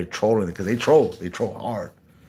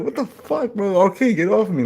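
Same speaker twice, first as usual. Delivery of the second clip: high-pitched imitation of a startled victim; comic, high-voiced objection; mock alarmed protest; 10 semitones higher than usual. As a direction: squeal it like someone trapped in a hug. Hugged stranger protests, acted out.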